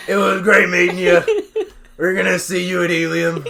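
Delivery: friendly words, combative loud delivery; angry-sounding farewell. Retold or imitated. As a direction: say a friendly goodbye like picking a fight. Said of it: "You sounded fucking angry".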